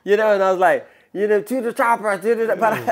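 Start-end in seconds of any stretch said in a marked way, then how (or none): 1.4-2.6 Schwarzenegger attempt. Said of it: "Arnold Schwarzenegger's voice".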